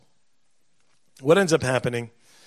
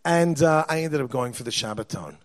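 usual speaking voice

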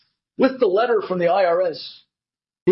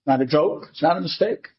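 normal talking voice

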